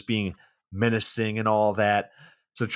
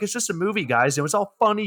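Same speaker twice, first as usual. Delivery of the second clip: much higher, breezy dismissive actor voice; mock carefree Ben Stiller; 9 semitones higher, faster than usual. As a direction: brush it off like a clueless star. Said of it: "he's like".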